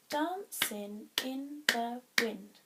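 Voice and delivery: "dancing in the wind" slow, one beat per syllable; counting syllables. Shown as a demonstration. Teacher counting the haiku's first line, slow syllable by syllable. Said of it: "Five syllables".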